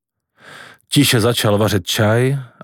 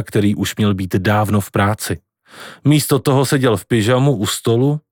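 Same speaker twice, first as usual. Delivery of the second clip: ordinary delivery, nothing marked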